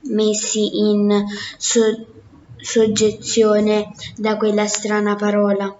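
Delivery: ordinary delivery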